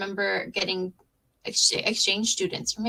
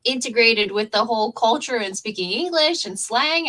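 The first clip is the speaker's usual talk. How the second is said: ordinary delivery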